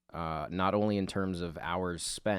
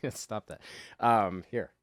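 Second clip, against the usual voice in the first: normal talking voice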